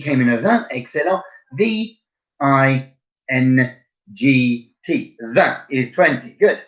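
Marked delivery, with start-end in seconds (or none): 1.5-5.2 "I N G T" letter by letter, slowly; slow spelling out. Shown as a demonstration. Teacher spelling vingt slowly for pupils. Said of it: "How do you spell it?".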